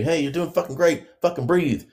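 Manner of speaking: high, chirpy mock pep talk; mock cheering coach. Husband mocking a pep-talking coach in labor, high and chirpy. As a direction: parody a cheesy birth coach cheering her on.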